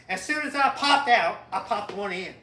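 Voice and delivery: emphatic Southern punchline; punchline repeat. Shown as a demonstration. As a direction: hit the punchline hard in Jimmy's voice. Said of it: "You know what I'm saying?".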